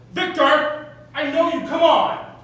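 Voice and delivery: loud, high, pressing outburst; exasperated shout. Acted. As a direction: call him out loudly. Larry confronts Victor.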